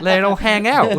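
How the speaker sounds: put-on high, loud laughing Australian accent. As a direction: laugh out a mock Aussie line. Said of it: "I love an Aussie".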